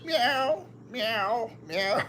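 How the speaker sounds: imitating a cat's meow. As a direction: mew like a crying little cat. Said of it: "he likes to cry".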